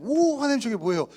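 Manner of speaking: loud, high burst of wonder; amazed cry. Retold or imitated. Adam crying out to God at a leaping salmon, loud and amazed. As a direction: gasp it out like a child seeing a marvel.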